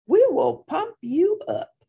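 high, loud dog character; woofed reassurance. A narrator's reading. Don Diego encouraging the gatito, woofed. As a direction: pump him up with big, high emphasis. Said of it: "woofed Don Diego".

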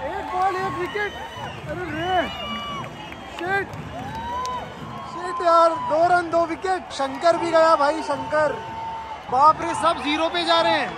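high-pitched, excited exclaiming; stunned fan in real disbelief; agitated disbelief. Real, not acted. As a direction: call out the collapse, voice pitched high. Wickets falling fast.

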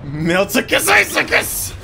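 hammed-up wizard voice, slow and climbing; play-acted incantation; mock spell incantation. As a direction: drag out the pretend spell. Casting a made-up game spell.

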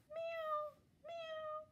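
high thin kitten mewing; tiny kitten meow. A narrator's reading. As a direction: mew like a small kitten. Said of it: "She could only go".

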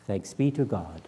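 ordinary talk

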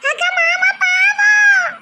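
high, slow, loud fake crying; bride's mock wail. New bride pleading for the husband in a loud mock wail.

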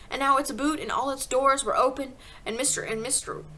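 plain reading voice

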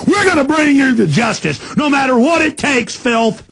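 roaring oath of justice; loud furious rant. Real, not acted. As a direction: swear vengeance at full volume. Threatening the hated enemy.

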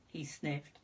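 plain reading voice

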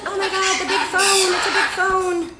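high, excited voice for a bird; bird's imagined voice. Acted. Bird gawking at the big phone, high mock-awed voice. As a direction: pitch it way up as the bird reacting.

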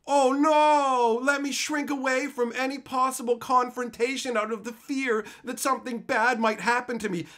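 scornful imitation of someone too scared to speak up; mock fearful sarcasm. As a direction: ridicule cowardice with exaggerated alarm. Sarcastic mockery of timid people.

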